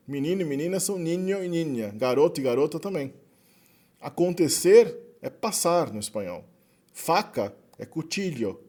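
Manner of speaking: ordinary delivery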